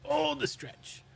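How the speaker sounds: ordinary talk, nothing marked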